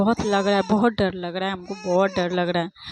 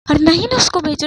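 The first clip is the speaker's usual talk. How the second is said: panicked shout; 6 semitones higher, 10 dB louder, faster than usual. Real, not acted. Panicked plea in scary moment.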